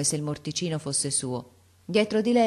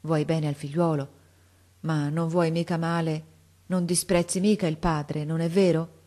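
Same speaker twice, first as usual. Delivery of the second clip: ordinary delivery, nothing marked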